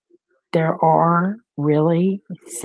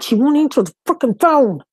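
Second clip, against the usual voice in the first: higher and louder, frantic; panicked outburst; 7 semitones higher, 5 dB louder than usual. Real, not acted. Grandmother telling her viewers her daughter won't answer, frantic. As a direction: blurt it out in a panic. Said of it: "I start freaking panicking".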